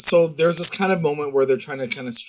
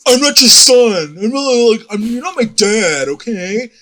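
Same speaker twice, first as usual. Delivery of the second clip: mock angry teen; 10 semitones higher, 6 dB louder than usual. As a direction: yell it like a sulky teen. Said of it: "And Archie's like".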